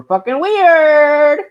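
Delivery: loud, high, drawn-out WEIRD; emphatic exclamation. Real, not acted. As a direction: belt out "weird" and hold it. Hammers home they were weird.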